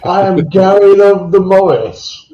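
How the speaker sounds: high, loud pompous proclamation; mock royal decree. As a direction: announce yourself like royalty, grandly. Grand self-announcement as Morris dancer.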